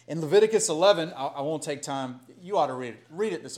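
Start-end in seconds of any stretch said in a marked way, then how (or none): none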